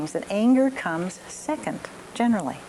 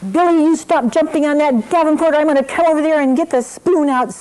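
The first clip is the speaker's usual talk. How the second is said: loud, high-pitched parental threat; angry scolding; 9 semitones higher, 7 dB louder than usual. Acted. Mother yells at son still jumping on the Davenport, furious scolding. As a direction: yell it at the child, patience used up. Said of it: "I'm getting really angry".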